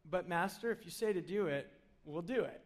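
low, soft, grudging give-in; reluctant agreement. Retold or imitated. Peter grudgingly telling Jesus he'll fish anyway, soft and resigned. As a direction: shrug it off and comply quietly.

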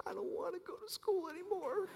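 put-on a scared little boy's whisper; small whisper. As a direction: whisper it, close to tears.

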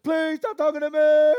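high, very loud mock wail; screaming child. Son begging his father to stop, mock screaming. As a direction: imitate a boy's crying shout. Imitates son's screamed plea.

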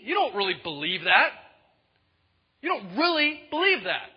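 acted divine anger at full voice; angry God. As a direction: challenge them forcefully, as an exasperated God. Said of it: "the first time God gets angry".

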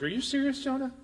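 incredulous, without raising the volume; mock incredulity. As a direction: ask it in playful disbelief. God voiced as incredulous at Jonah.

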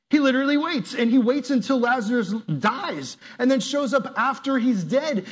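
loud, high preacher's build; preaching at full force. Acted. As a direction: drive the point home loud and high.

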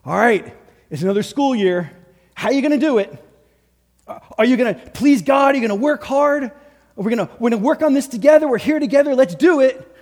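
put-on rally your kid for the new year; parent's pep talk. Parent gearing up a child for school, eager and loud. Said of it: "your mom or dad sat down with you and they were like".

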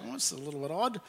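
ordinary delivery

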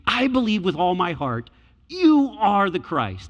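believe it with all my heart, fervent proclamation; fervent declaration. Shown as a demonstration. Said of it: "That's the way he's saying that".

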